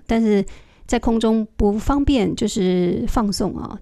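ordinary talk, nothing marked

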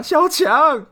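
high, loud wailing over a dying friend; hammed-up grief. Quoting a comedy film hero mourning his pet cockroach, overblown wail. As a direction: wail it like a melodramatic movie lament. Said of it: "周星驰《唐伯虎点秋香》里面那一个啊".

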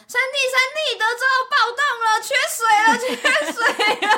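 high, fast panicked warning; excited shout. Retold or imitated. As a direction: blurt it out in a rush. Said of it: "打电话还是传讯跟我说".

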